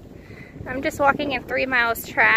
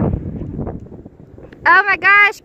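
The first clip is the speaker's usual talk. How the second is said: drawn-out awe; 4 semitones higher, 6 dB louder, much slower than usual. Real, not acted. Stretched-out awe at the view.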